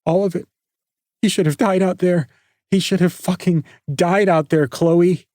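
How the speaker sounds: high, tearful and strained, a little fast at first; choking back sobs through the words; sobbing. A narrator's reading. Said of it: "Nikki sobbed and shuddered".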